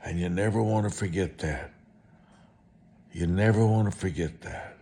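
normal talking voice

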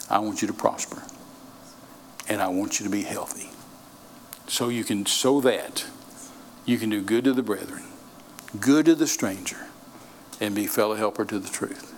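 soft, low, heartfelt reading; tender, earnest quote. Acted. Quoting John's letter to flock.

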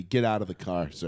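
dickhead cop. Retold or imitated. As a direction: talk down like a cop at a traffic stop. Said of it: "that guy looks like the cop".

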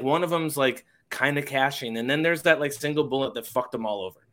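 normal talking voice

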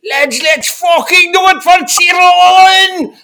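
high, loud, slow rallying roar; mock battle cry. Rallying cry after removing false teeth.

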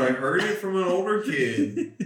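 high laughing reply. Real, not acted. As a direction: say it through laughter. Joking defence while laughing.

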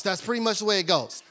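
usual speaking voice

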